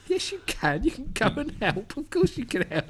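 high-pitched indignant retort; mock protest. Answering a man's excuse for not helping, high mock protest. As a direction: squeak it back in high mock disbelief.

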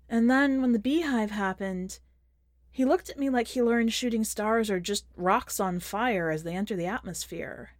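plain reading voice

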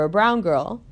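ordinary delivery

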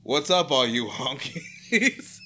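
slow, loud mock greeting, laughing; mock hype principal. Imagined principal greets students, slow and hammed-up. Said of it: "mixing very antiquated colloquialisms".

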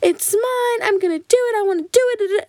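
high, whiny mimicked tantrum; bratty back talk. Girl talking back at her parents, mock bratty whine. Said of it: "Like, raise her voice".

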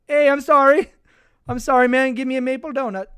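high, loud, muddled Canadian impression; hammed-up apologetic Canadian accent; mock Canadian accent. Host imitating a Canadian player apologizing, mock Canadian accent. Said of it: "He's Canadian. I'm sure he's like".

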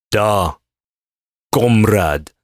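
put-on short, deliberate stab at a Russian accent; attempted Russian accent. Host retrying his failed impression, attempted Russian accent. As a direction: try a hammy Russian accent, word by word. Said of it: "Let's try that again".

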